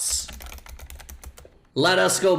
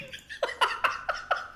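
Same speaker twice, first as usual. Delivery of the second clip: very high, wheezing laughter; breathy laugh; 8 dB quieter than usual. Real, not acted. Cracking up at own chatbot joke, wheezy laugh. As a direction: laugh till only air comes out.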